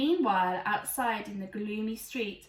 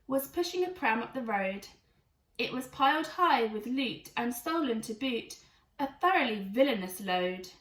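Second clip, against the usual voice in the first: ordinary delivery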